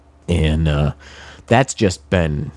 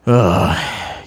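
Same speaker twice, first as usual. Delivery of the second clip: long exhaled groan of frustration; weary groan. Real, not acted. Podcaster fed up with money being tight, weary groan. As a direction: let out a tired "ugh".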